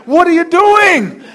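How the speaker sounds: husband demanding what his wife is doing; serious demand. Retold or imitated. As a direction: confront her over the sandwich. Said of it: "I'm like".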